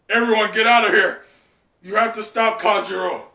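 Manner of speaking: fast, loud, high command; shouted order to the others to flee; urgent shout. Acted. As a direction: yell at them to run. Warrior orders everyone to flee.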